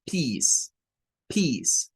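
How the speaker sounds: "peace" with a long ee sound; "peace" said slowly, long vowel; model pronunciation. Shown as a demonstration. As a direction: stretch the ee in "peace". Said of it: "el sonido largo de la vocal I".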